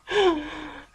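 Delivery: short high nervous laugh; nervous giggle. Real, not acted. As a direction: giggle nervously, can't help it. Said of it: "I laugh, it's a tick".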